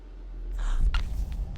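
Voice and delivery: sharp breathless gasp of fright; frightened gasp. Real, not acted. Gasping at a noise in the empty house. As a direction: gasp as if something moved upstairs.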